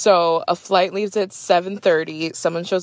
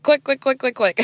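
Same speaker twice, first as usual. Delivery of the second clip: higher, quick eager chant; eager clicking; 7 semitones higher than usual. Retold or imitated. Fan rushing to click the new video, eager. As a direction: tap it out impatiently. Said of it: "I was like".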